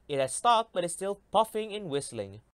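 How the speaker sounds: usual speaking voice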